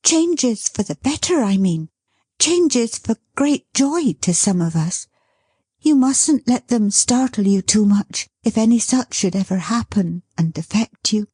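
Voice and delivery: put-on gentle, bright young wife; Dot's voice. Dot continues.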